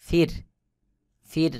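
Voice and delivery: ফির said with a light, thin র; the র in ফির kept light and thin, said normally. Shown as a demonstration. Teacher shows students the thin র in ফির, plain. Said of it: "স্বাভাবিকভাবে উচ্চারণ হবে".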